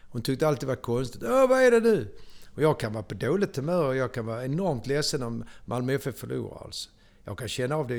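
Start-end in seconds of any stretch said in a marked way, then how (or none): 1.2-2.2 high, loud mock alarm. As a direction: ham up a startled worried cry. Wife's alarmed question retold. (högt, spelat förskräckt)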